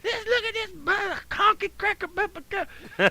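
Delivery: high, sputtering imitation of a tirade; high-pitched caricatured rant; mock ranting. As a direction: imitate her spewing abuse in a high voice. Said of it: "she's spewing racial shit at me".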